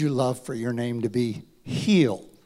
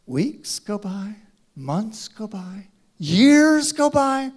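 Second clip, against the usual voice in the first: building; 5 semitones higher, 6 dB louder, slower than usual. Acted. Building the years of waiting.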